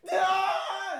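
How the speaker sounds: very high held yell; retold scream. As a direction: scream at the friend to get out. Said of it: "screamed at him".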